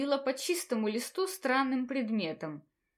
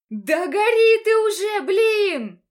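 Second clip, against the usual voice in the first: exasperated scream; 9 semitones higher, 11 dB louder, slower than usual. Acted. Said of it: "в сердцах закричала".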